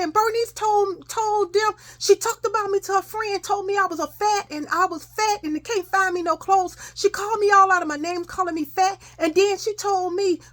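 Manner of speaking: high, aggrieved tattling; reenacted upset complaint. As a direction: keep wailing out each grievance, acted. Upset child keeps complaining to father.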